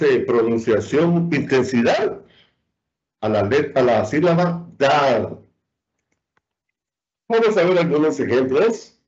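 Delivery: normal talking voice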